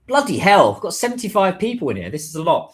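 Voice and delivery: loud, high-pitched astonished outburst; real surprise. Real, not acted. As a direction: blurt it out, amazed at the crowd. Surprised at sudden audience count.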